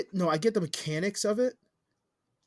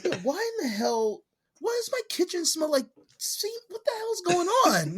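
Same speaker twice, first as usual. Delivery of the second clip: high-pitched, baffled mock parent; exaggerated high-pitched bewilderment; bewildered parent; 11 semitones higher than usual. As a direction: act out a puzzled parent, voice climbing. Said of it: "And you're like".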